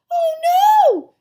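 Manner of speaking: high, very loud cry of horror; girl's scream. A narrator's reading. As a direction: scream it like a horrified little girl. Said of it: "Sheila screamed".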